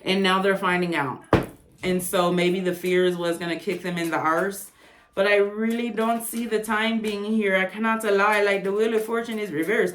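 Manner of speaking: ordinary talk, nothing marked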